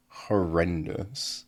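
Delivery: ordinary delivery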